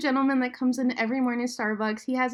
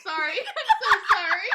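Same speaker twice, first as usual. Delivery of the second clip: high, giggling sorry; laughing apology; 10 semitones higher, faster than usual. Real, not acted. Friend apologizing for her joke through giggles.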